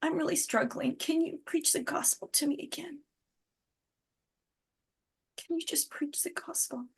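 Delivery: high, soft plea for help; a weary man begging gently; pleading dean. Retold or imitated. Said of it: "his dean called him in and said".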